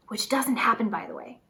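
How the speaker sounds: quick whispered side remark; whispered aside. Real, not acted. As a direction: whisper it under your breath. Sarcastic aside about fearmongering.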